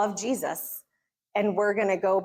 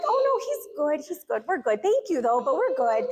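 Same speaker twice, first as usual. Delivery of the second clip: overly polite brush-off; 6 semitones higher than usual. Retold or imitated. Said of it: "And we're like".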